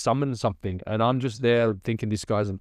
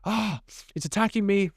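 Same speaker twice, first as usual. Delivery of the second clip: high, strained imitation of a man in pain; mock pained cry; 10 semitones higher than usual. Master retelling his pain, high mock cry. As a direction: play the master crying out as the shirt attacks him. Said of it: "And he starts screaming".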